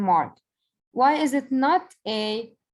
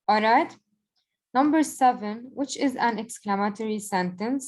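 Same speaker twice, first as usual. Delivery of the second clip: usual speaking voice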